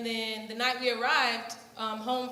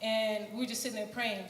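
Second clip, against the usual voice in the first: usual speaking voice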